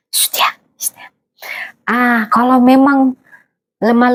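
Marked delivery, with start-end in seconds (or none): 0.1-1.1 soft whispered shush. Real, not acted. Mother settling her child mid-talk, hushed whisper.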